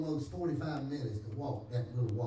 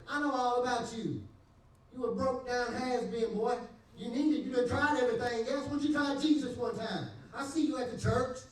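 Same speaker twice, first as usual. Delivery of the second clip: higher, louder scolding sermon voice; raised voice of a friend preaching; preachy friend; 9 semitones higher, 4 dB louder than usual. Retold or imitated. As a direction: preach at the has-been, half laughing.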